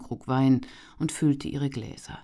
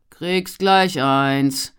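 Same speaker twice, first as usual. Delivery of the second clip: loud, slow call back; 10 dB louder, much slower than usual. A narrator's reading. Servant calling back to the impatient guest, loud. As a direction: brush him off loudly from afar. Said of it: "rief er".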